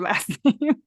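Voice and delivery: usual speaking voice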